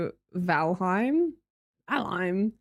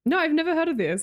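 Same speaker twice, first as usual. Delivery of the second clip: usual speaking voice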